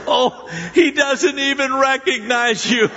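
slow, high, laughing quote; laughing through it. Retold or imitated. Retelling the driver's remark to the congregation, laughing. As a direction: get the words out through laughter.

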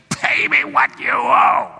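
high, slow furious shout with every word hammered; angry shout. Acted. Servant choking his fellow servant, furious shout. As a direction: bark the demand like a strangling creditor. Servant grabs debtor by throat.

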